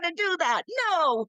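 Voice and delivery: high-pitched mock protest at the editors; re-enacted yelling. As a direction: act out yelling at absent editors. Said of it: "a monologue of yelling at the editors".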